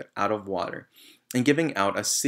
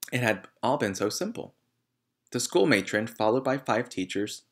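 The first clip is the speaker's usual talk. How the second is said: plain reading voice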